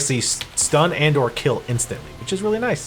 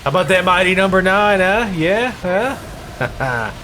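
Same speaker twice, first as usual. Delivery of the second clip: loud, higher teasing gloat; mocking announcer; 6 semitones higher, 3 dB louder, slower than usual. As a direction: gloat loudly at the joke. Mocking Mega Man jump.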